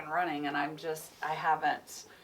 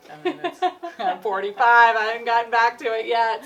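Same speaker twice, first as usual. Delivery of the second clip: laughing; 7 semitones higher, 17 dB louder than usual. Real, not acted. Laughing about her age.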